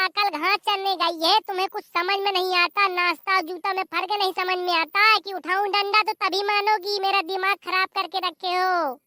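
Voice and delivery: ordinary talk, nothing marked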